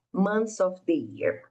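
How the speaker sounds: months said without the th, like mons; teacher model, slow and clear; model pronunciation. Shown as a demonstration. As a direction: drop the th in months, say mons of the year. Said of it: "que você pode falar months".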